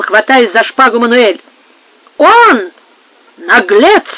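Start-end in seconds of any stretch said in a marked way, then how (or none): 2.2-4.0 loud, punched accusation; dramatic angry outcry; shouted retort. A narrator's reading. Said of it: "крикнул в ответ офицер".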